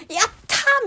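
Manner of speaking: high, bright cry of triumph; cheer. Retold or imitated. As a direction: whoop like you just won. Alone on bridge, cheering. (高い声で歓声)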